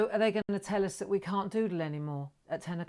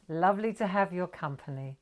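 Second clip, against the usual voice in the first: normal talking voice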